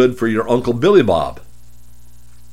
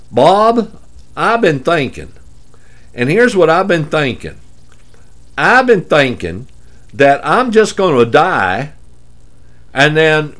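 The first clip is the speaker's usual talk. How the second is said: higher-pitched, hammed-up Dallas drawl; 5 semitones higher than usual. Complacent client telling Bob his plan, put-on Dallas drawl. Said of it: "I can talk like this easily".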